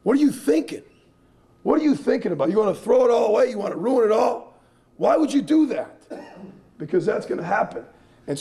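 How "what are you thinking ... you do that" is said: raised, higher-pitched scolding of a wayward son; scolding father. Retold or imitated. Coach scolding a player like his own son, high and sharp. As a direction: demand answers like a disappointed dad. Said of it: "talk to him like he's my son".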